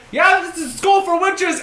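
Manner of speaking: high, loud put-on voice; passer-by character. Someone stopping the fighter mid-brawl, high loud character voice. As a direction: call out as an excitable bystander. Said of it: "someone stops you and he's like".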